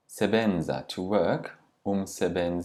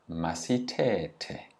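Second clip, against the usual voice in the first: slow model word; much slower than usual. Shown as a demonstration. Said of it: "teacher models new word slowly".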